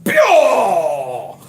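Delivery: loud high shout of the name Bill; a hammed-up imitated outburst; mock outburst. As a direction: blurt "Bill!" as a sudden yell. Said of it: "He did an outburst".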